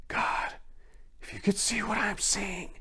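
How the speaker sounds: high, faint trembling whisper; frightened friend whispering from the vault; shaky whisper. A narrator's reading. Terrified friend calls up from the vault, shaky whisper. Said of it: "called from below in a shaky whisper".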